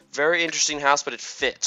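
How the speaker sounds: tough kangaroo lady. Acted. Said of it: "She's, like, a hardcore".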